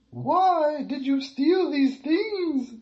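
very high, loud, slow demand; drawn-out shrieking queen; stern queen. Acted. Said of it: "asked the queen".

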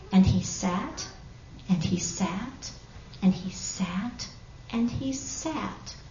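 soft, slow, patient repetition; hushed storytelling. A narrator's reading. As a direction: draw out the long wait quietly. Long patient wait, repeated softly.

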